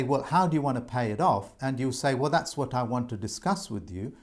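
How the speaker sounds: usual speaking voice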